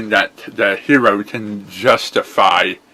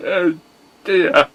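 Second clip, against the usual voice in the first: bright, high cartoon pony hero; 9 semitones higher than usual. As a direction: play the chipper young pony hero.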